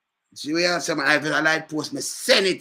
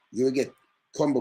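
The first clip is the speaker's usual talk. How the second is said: normal talking voice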